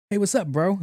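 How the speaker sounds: high-pitched bro greeting; mimicked casual kid. Young intake kid greeting a patient, high casual mimicry.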